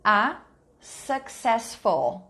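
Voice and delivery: drawn-out successful, syllable by syllable; slow, clear dictation. Shown as a demonstration. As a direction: stretch successful slowly for spelling. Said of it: "see if you know how to spell this word".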